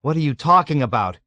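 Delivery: shout with dread. A narrator's reading. Yossarian stunned by Dunbar's look, shouting with dread. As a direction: shout back, full of dread. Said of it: "he shouted with dread".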